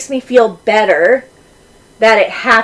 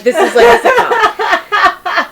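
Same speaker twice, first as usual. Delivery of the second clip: laughing; 10 semitones higher, slower than usual. Real, not acted. Laughing at her story.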